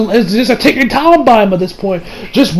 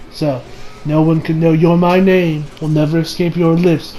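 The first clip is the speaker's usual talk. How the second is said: low, softened villain voice; lightly voiced quiet warning; menacing villain; 5 semitones lower, 5 dB quieter than usual. Villain's closing threat.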